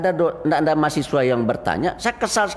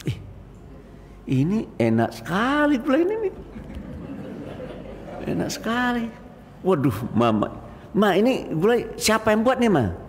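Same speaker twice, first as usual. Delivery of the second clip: higher, smiling voice full of praise; 8 semitones higher than usual. Retold or imitated. Husband praising his wife's curry with a smile. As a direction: gush sweetly over the curry. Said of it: "kamu tersenyum".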